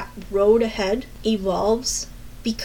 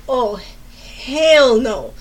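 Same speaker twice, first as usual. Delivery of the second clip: slow, drawn-out, louder "hell no"; indignant refusal; 2 semitones higher, 6 dB louder, much slower than usual. Retold or imitated. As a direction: stretch it out in outrage.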